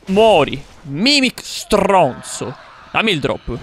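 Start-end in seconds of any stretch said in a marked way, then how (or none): 0.1-2.5 drawn-out insult. Real, not acted. Kills the mimic, gloating.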